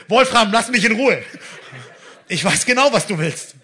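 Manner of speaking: loud, high-pitched mock exasperation; comic outburst at a pestering caller; exasperated rebuff. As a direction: cut the caller off, agitated and loud. Acted fending off unwanted phone caller.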